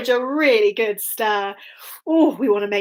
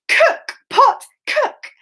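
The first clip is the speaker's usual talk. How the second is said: high, loud magic chant; high chanted spell; magic words; 13 semitones higher, 3 dB louder, much slower than usual. Shown as a demonstration. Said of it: "we're gonna go".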